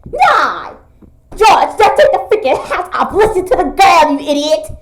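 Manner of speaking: high, loud mock anger; laughing mock shout at George; playful shouting. Pretend quarrel in play.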